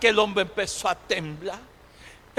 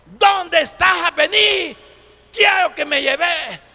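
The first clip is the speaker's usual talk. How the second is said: storyteller's bold yell into the distance; acted shouting; 6 semitones higher, 6 dB louder than usual. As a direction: call death out at full voice, stretching the words. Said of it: "gritando aquel hombre".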